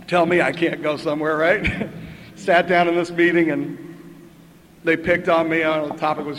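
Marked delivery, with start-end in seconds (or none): none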